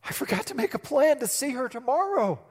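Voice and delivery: mock panic of a lovesick student; anxious rambling. Young self's anxious rambling retold.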